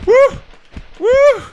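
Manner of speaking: two very high, loud whoops. Real, not acted. Gamer dodging trouble in the game, high whoops. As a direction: let out piercing startled whoops. Startled whoops during gameplay.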